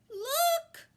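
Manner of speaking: sudden high piercing scream; a girl screaming at the fading pig. A narrator's reading. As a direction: shriek it in alarm. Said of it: "screamed Fern".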